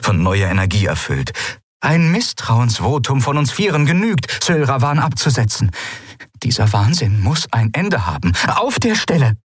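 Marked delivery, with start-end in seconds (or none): 1.8-9.4 higher, rising to a sharp demand; determined officer. Acted. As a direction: push the vote through with resolve. (entschlossene Offizierin, fordernd)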